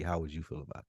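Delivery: ordinary talk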